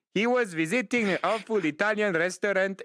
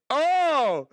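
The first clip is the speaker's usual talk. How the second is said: drawn-out, higher-pitched "Oh"; exclaimed oh; 6 semitones higher, 4 dB louder than usual. Acted. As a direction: let the oh swoop up and fall. Acted exclamation inside the story.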